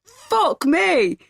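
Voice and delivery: put-on high, excited drunken shout; drunk man shouting. A narrator's reading. Drunk stranger shouts after a fleeing woman, excited. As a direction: shout it like an amazed pub drunk. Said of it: "the beer-bellied man saying".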